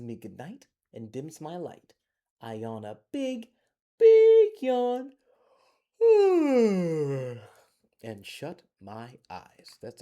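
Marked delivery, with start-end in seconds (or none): 4.0-5.1 huge, much louder yawned-out big yawn, pitched way up; reading a bedtime story to kids, acting out the big yawn. 6.0-7.5 long, loud falling yawn sigh; reading a bedtime story to kids, finishing the big yawn; loud yawn ending. Acted.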